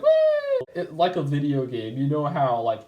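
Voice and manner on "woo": very high squealed woo; mock cheer. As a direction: whoop like winning a video game boss. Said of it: "They're just like".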